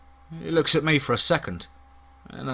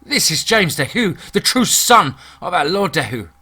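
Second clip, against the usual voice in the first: raised, higher-pitched yell at fools; indignant yell; 5 semitones higher, 5 dB louder than usual. A narrator's reading. Michel rebukes guards blocking the true son, yelling indignantly. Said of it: "Michel yells".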